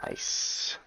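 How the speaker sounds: ordinary talk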